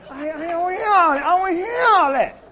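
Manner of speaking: high, loud, slow fed-up yell; exasperated shout. Retold or imitated. Retelling dorm chief frustration.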